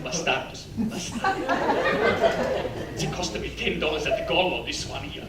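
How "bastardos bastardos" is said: mother scolding. Retold or imitated. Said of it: "She said".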